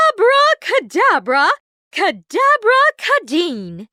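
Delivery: high, loud spell, slowing at the end; loud incantation. A narrator's reading. Fairy godmother casting a spell on the pumpkin, loud and grand. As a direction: proclaim the magic words. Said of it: "cried out".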